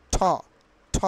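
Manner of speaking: "ঠ" with a burst of breath; "ঠ", a clearly aspirated T. Shown as a demonstration. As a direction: say "ঠ" as a puffed tho. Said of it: "ঠয়ের মতো করে".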